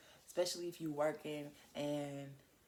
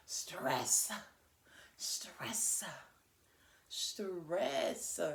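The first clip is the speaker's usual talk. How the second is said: "Stress" drawn out, each one set apart; dramatic emphasis; much slower than usual. Real, not acted. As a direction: announce "Stress" slowly with a hard, hissing finish. Announcing the topic for emphasis.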